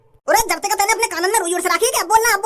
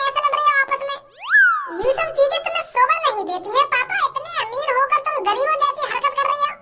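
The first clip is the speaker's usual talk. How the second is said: put-on higher child's voice, lecturing his parents; a kid telling off mum and dad; scolding child; 5 semitones higher than usual. (डाँटता हुआ बच्चा, ऊँची आवाज़ में)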